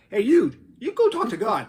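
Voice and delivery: hammed-up scientist egging a colleague on; scientists sending one off. Reading a joke to his wife, scientists' playful shove. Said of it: "Like that".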